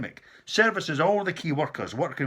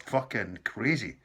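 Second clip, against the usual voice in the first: ordinary delivery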